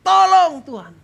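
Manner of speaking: loud, high cry to God; shouted cry for help. Shown as a demonstration. Preacher shows congregation how to cry to God, shouted. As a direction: call out urgently for rescue. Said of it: "yang perlu Anda lakukan yaitu teriak".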